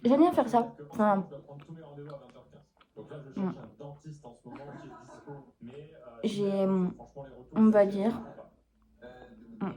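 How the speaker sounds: talking with a mouth full of food. Real, not acted. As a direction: speak through a mouthful of breakfast. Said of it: "je vous parle à bouche pleine".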